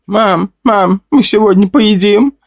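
put-on high little boy's voice; eager hungry child asking mom; young boy. A narrator's reading. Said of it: "спросил мальчик лет восьми".

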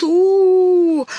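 imitating a howling gale; howling wind. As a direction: howl like the wind. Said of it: "так и ветер ревёт".

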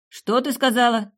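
a loud, high call through the door; guard shouting to ask what she said; shouted. A narrator's reading. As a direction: shout the question from outside. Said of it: "крикнул Перс".

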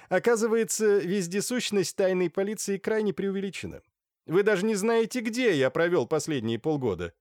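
high-pitched, amused gentleman; mocking Naledinsky. A narrator's reading. Naledinsky mocks the secret police, high amused voice.

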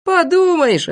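high, loud dismissive cry; scoffing. A narrator's reading. Boy dismisses the departed leader, scoffing. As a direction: brush it off, stretching the word high.

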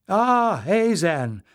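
high, loud glad greeting; welcoming call. A narrator's reading. She welcomes the young Plume, calling out. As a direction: hail the newcomer with a lifted exclamation.

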